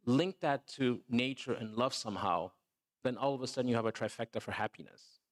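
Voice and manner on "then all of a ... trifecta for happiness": ordinary talk, nothing marked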